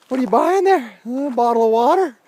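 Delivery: mock cheerful small talk; chirpy friend. Friend chatting, retold.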